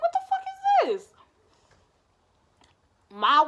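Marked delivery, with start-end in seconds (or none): 0.0-1.1 sudden high-pitched what the fuck; shocked outburst. Retold or imitated. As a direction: jump way up in pitch in disbelief. Blocked in by a strange car.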